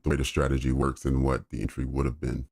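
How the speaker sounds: ordinary talk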